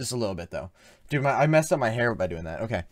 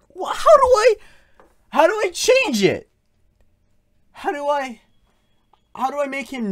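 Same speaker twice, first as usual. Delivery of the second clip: panicked high voice; 11 semitones higher, 14 dB louder than usual. Real, not acted. Panicked under attack.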